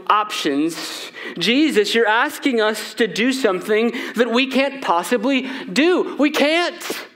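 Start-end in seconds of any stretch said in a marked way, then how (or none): 1.4-7.1 pleading protest. Retold or imitated. Disciples protesting to Jesus.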